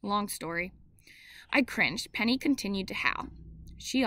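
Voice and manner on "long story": ordinary delivery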